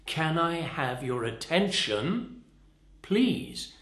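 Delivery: slow, ATTENTION stressed, then a high PLEASE; accented key word. Shown as a demonstration. Trainer shows where the accent goes, slow stressed ATTENTION. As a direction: draw it out, lean on "attention", pause, lift "please". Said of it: "where you put the accent".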